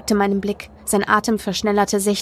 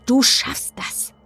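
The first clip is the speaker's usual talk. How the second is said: slow, emphatic urging with a high start; snapped encouragement; 5 semitones higher, much slower than usual. A narrator's reading. Boy urging his crying friend on, snappish. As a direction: push them hard through it, slowly. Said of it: "fuhr Kit mich an".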